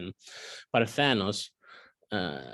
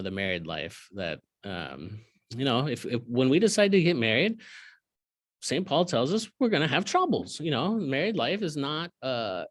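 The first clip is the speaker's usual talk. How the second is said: usual speaking voice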